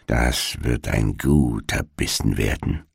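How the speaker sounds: low, soft, greedy witch murmur; murmured to oneself. A narrator's reading. Witch eyes the sleeping children as food, murmuring to herself. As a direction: mutter it privately, savouring the thought. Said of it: "so murmelte sie vor sich hin".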